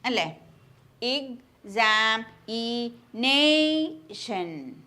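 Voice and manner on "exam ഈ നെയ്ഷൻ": "exam-, ഈ, നെയ്ഷൻ" slowly split into separate syllables. Shown as a demonstration. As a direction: stretch each syllable of the word. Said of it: "transcribe ചെയ്യുമ്പോ എങ്ങനെയാണ്".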